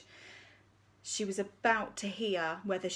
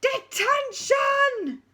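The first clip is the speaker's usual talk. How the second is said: high, loud drawn-out bellow; deputy head screaming the punishment; furious shout; 10 dB louder, much slower than usual. Acted. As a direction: roar detention at the school. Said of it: "she boomed again".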